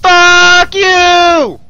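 long, high drawn-out curse; enraged yell. Real, not acted. Gamer cursing after dying in game, enraged yell. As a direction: scream it out, holding the vowel.

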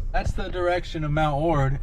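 ordinary talk

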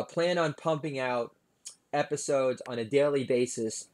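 usual speaking voice

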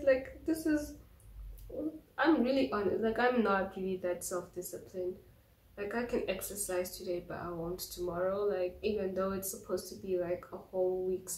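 muffled behind her fingers; speaking through fingers; fingers over mouth. Real, not acted. Seen 0: Fingers rest on and over her lips through most frames.

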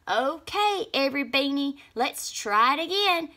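weary sighing leader; high, tired try again. A narrator's reading. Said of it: "said Lima Bean with a sigh".

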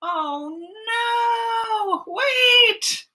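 high wailing cry. Acted. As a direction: wail out in dismay. Cry over the crash.

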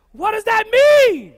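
very loud, high, drawn-out question; shouted question. Real, not acted. Preacher asking the congregation about street slang, shouted question. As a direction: belt the question out to the crowd.

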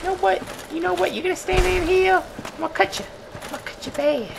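high, squeaky little tough-guy voice; put-on high voice, mock menacing; mock threat. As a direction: threaten in a tiny, high-pitched voice. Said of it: "I just was like".

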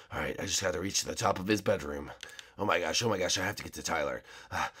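low, hushed panicked muttering; a frightened kid whispering to himself in the dark; low panicky voice. Acted. Alone at night, panicking about who to wake, in a low hushed voice.

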